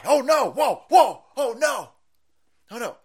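high-pitched mock alarm; mock panic. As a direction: squeal it in fake panic.

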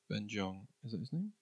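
ordinary delivery

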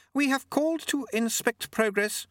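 lightly voiced, haughty lady. A narrator's reading. Lady Lejeune speaks.

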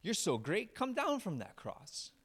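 mock the man on the cross; mocking taunt. Crowd jeering at Jesus on the cross, sarcastic mocking taunt. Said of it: "are now yelling at him".

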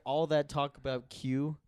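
ordinary delivery

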